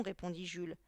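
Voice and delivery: ordinary delivery, nothing marked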